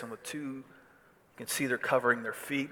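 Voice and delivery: usual speaking voice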